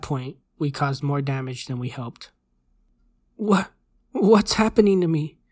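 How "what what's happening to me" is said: put-on high, loud, panicked boy; frightened stutter. A narrator's reading. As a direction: stammer it out in fright, voice raised. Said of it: "the kid stuttered".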